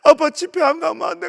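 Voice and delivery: put-on high, loud pleading little girl; begging child. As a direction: beg daddy not to go. Daughter begging dad to stay. (아빠에게 조르는 어린 딸)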